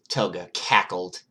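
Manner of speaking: ordinary delivery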